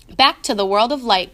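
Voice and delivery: plain reading voice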